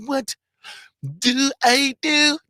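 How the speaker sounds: high, slow, drawn-out squeal; catchphrase squeal. Acted. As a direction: stretch it out, way up high. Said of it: "That's gonna be the new discount code".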